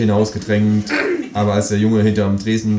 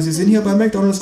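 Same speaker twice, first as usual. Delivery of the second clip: put-on high-pitched teenage boy voice; high teen counter-clerk voice; sixteen-year-old cashier; 11 semitones higher than usual. A narrator's reading. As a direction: play a young fast-food cashier, pitched up. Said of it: "sagt der Junge".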